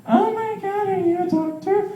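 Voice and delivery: put-on very high breathless gushing girl; swooning girl. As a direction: gush like a starstruck girl. Girl voiced, swooning at doctor.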